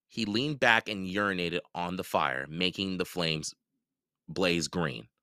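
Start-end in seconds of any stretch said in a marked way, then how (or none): none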